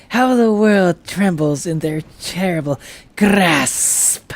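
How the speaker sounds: slow, hushed loyal servant; slow reverent minion voice; devoted servant voice. Acted. Voicing a game character's dialogue.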